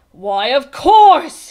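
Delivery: very loud, drawn-out delighted realisation; gleeful. A narrator's reading. King struck by a wild idea, gleeful. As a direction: crow it slowly and loudly. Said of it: "he began laughing".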